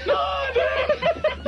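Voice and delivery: very high, drawn-out laughing denial; the boss denying it in a high voice; Joe impression. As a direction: squeak it up high, slow and amused. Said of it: "And I'd go".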